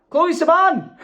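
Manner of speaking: name burst out high and loud; suddenly realising who sent it; reading the name back, thrilled; delighted recognition. Real, not acted.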